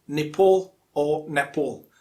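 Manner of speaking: Nepal as ni-PAWL, then ne-PAWL; slow "Nepal", both ending in PAWL; two correct variants. Shown as a demonstration. Teacher offering both accepted ways, two variants of Nepal. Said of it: "pronounced in two or more different ways".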